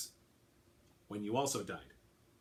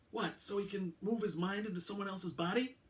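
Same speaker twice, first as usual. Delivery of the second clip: higher-pitched sceptical cop voice; incredulous detective; 9 semitones higher than usual. A narrator's reading. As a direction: scoff at the idea. Alvarado voiced in audiobook.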